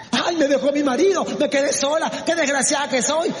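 high-pitched imitation of an abandoned wife; mock lament. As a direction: act out wallowing in self-pity. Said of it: "gente que lamenta". (lamento fingido de esposa abandonada)

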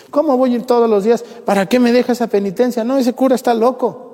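high-pitched, quick indignant objection; mock-outraged complaint in a high voice; indignant protest. Mimics parishioner rejecting priest's penance.

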